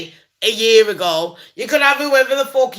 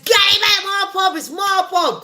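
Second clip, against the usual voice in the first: mock yell, much higher; landlord shouting; 10 semitones higher than usual. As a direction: demand it back like the owner of the pub. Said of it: "And then the man says".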